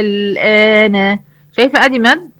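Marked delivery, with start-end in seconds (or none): 0.0-1.2 الآن chanted slowly, the long alif held for six beats; tajweed recitation. Shown as a demonstration. Teacher showing Sahar the word, slow chanted recitation. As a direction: recite الآن drawing out the madd. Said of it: "اللي هي كلمة".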